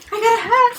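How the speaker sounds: high, excited exclamation in full voice; thrilled. Real, not acted. Fan who got a hat, thrilled exclamation.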